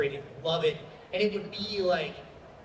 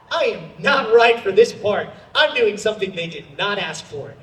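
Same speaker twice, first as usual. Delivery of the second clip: loud; 2 semitones higher, 9 dB louder than usual. Retold or imitated. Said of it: "Sometimes I'm like".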